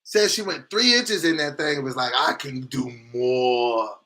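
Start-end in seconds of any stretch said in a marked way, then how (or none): 2.2-4.0 slow, drawn-out imitation of her; lightly voiced boaster. Streamer imitating the woman in the story, mock boast. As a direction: stretch the words out, mock determined.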